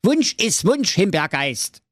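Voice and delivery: high, insistent hero voice; imperious call. A narrator's reading. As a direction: insist on your wish, high-pitched. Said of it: "rief der Schwerthelm". (herrisch gerufen, hohe Stimme)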